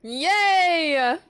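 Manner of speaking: high loud yay; excited cheer. Real, not acted. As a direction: cheer with delight. Capture worked.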